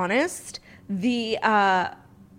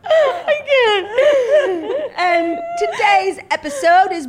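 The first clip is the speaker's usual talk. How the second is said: much higher, louder helpless laughing; laughing fit; 13 semitones higher, 7 dB louder, much slower than usual. Real, not acted. Host losing it before the sponsor read, laughing. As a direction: try to speak through giggles.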